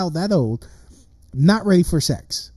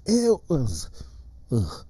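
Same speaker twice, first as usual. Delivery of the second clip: real revulsion, groaned out; recoiling, sickened interjections; disgusted; 4 semitones lower, 7 dB quieter than usual. Real, not acted. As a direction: break off into repeated noises of disgust. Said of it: "I'm disgusted by the thought".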